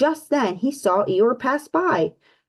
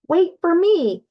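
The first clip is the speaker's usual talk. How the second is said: shouting; 8 semitones higher, 3 dB louder, slower than usual. Acted. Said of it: "Piglet shouted".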